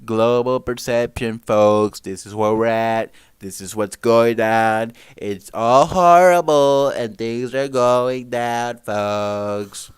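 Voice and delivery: loud, drawn-out put-on voice; mock broadcaster. Streamer parodying a media mouthpiece, loud mock announcer. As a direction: belt it out slowly to the folks.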